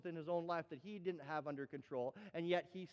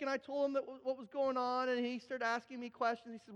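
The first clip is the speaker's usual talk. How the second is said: ordinary talk, nothing marked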